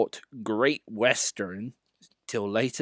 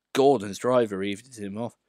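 ordinary delivery, nothing marked

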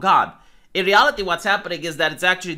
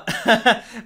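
short, higher-pitched chuckle; amused chuckle; 5 semitones higher, 3 dB louder than usual. Real, not acted. Joking to viewers about asking a girl out, amused chuckle. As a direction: chuckle at your own remark. Chuckles at own dating joke.

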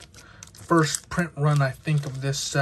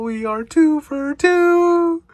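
excited; 13 semitones higher, 8 dB louder, slower than usual. Real, not acted. Second good pull in a row.

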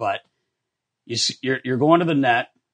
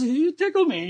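much higher, silly singsong goalie voice; goofy high voice; 14 semitones higher than usual. Retold or imitated. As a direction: mimic him sweetly, way up high. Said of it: "he goes".

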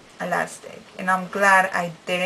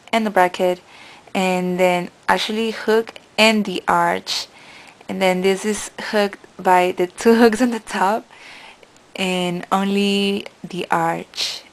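speaking with lip pulled aside; finger pulling lip; 5 dB louder than usual. Real, not acted. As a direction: mouth held open by finger. Seen 1: Finger holds lower lip corner open showing braces throughout.

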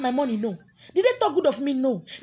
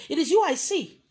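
ordinary talk

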